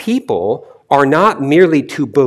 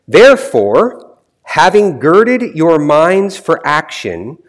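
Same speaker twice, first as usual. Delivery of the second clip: raised, emphatic scripture reading at an unbroken pace; 3 semitones higher than usual. Real, not acted. Preacher reading the chosen Bible passage to listeners, emphatic scripture reading. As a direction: read the verse out to a congregation, pitched up. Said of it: "I'm going to read to you".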